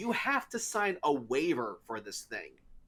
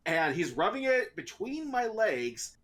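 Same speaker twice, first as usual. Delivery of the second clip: ordinary talk, nothing marked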